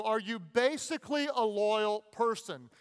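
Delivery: ordinary talk, nothing marked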